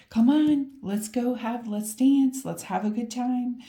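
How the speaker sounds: excited voicing. Voicing the card's energy as an eager party call. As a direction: pump up the invite to dance. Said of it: "your energy is like".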